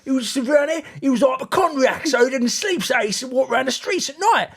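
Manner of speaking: lightly voiced know-it-all driver; imitating the taxi driver. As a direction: parrot the driver's confident mistake. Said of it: "he went".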